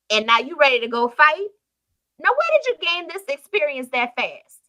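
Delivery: put-on King Saul scoffing at the shepherd boy; incredulous Saul. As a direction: stretch out the doubt like a skeptical king. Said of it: "he's saying to him".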